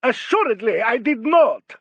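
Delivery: high, loud, haughty agreement; grand prima donna outburst; indignant diva. A narrator's reading. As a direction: declare it with imperious outrage.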